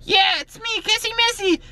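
high put-on girly character voice; Kissy Missy voice. Wearing the mask, he greets viewers in a high Kissy Missy voice. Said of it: "I'm gonna wear the Kissy Missy mask".

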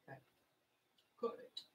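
normal talking voice

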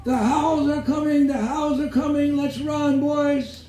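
very high-pitched fake panic; mock screaming. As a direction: shriek the alarm high, hamming it up.

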